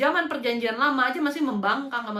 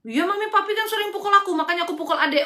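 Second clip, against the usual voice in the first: imitated child, pitched high and a bit louder; child answering back; 6 semitones higher, 5 dB louder than usual. Child answering a parent about being hit, high imitated protest. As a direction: play a kid loudly telling on the parents. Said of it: "ketika kamu tanya anakmu".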